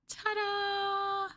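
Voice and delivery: high, bright ta-da as she unveils the gift; excited reveal. Acted. Girlfriend unveiling a collar to her partner, excited. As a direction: show it off like a magic trick.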